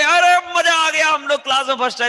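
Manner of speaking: very high, loud cheer of triumph; jubilant yell. Acted. Pupils celebrating coming first in class, jubilant yell. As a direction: yell it like you just topped the class. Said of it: "तो कितनी जोर से बोलोगे".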